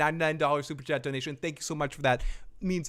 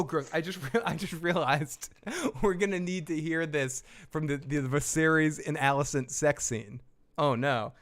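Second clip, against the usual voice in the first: ordinary talk, nothing marked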